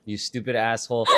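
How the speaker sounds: ordinary delivery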